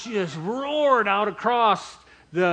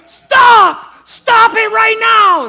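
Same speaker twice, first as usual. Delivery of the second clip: very high, very loud screamed command, drawn out at the end; imitated furious yelling; 17 dB louder, much slower than usual. Imitating three women confronting the band, full-voiced yelling. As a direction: yell it full force as an outraged protester. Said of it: "They just started yelling".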